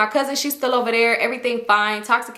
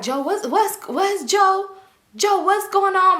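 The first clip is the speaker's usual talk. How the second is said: mock drunk cousin; 9 semitones higher, slower than usual.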